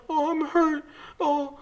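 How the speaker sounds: high whiny mock moan of pain; faking hurt. Narrator mocks the lady faking injury for a stretcher, high whiny moan. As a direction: whimper it like a faker playing injured. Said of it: "She's like".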